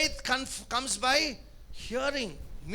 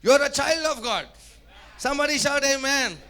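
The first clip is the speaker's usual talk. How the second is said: loud, raised preacher's voice; full-force preaching; 3 semitones higher, 5 dB louder than usual. Real, not acted. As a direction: rouse the congregation with loud declarations. (గట్టిగా ప్రకటించే బోధకుని స్వరం)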